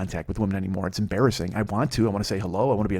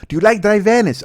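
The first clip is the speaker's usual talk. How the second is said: loud, high-pitched imitation Mexican accent; heavy Mexican accent; 8 semitones higher, 8 dB louder than usual. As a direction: repeat it louder in a thick mock Mexican accent. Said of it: "she had a really heavy accent".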